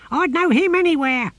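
high, loud, outraged creature shriek; screaming tortoise. A narrator's reading. Tortoise recognising his enemy from the box, screaming high. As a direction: screech it far above normal pitch. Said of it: "screamed the voice of the tortoise".

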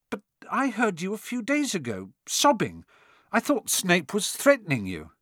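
put-on high, earnest young voice; Harry, puzzled boy. A narrator's reading. Harry questions Quirrell about the sobbing, puzzled. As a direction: press him with confused urgency. Harry's dialogue in narrated story.

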